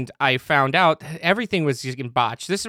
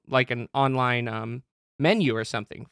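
normal talking voice

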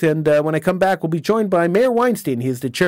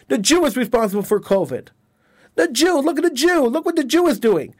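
lightly voiced sneering accuser, higher; mocking accuser; 6 semitones higher than usual. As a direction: mimic the finger-pointers, mildly mocking. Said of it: "point the finger and say".

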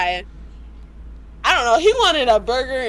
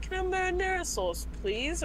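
imitated British customer; 7 semitones higher, 8 dB quieter, faster than usual. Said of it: "four British people that day".

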